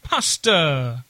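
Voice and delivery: hammed-up Italian accent on Pasta; mock Italian accent. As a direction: roll out Pasta like a cartoon Italian chef. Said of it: "Italian accent".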